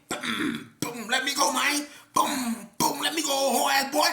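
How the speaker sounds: high loud yell with booms; shouted struggle; yelling while hitting. Retold or imitated. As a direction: yell as you pound him.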